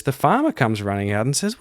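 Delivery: normal talking voice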